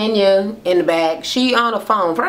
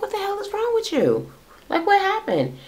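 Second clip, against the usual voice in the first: high, hushed mock-hysterical voice; imitated hysterical crying; 4 dB quieter than usual. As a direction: retell it in a much higher, softer, upset voice. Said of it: "she's crying hysterically".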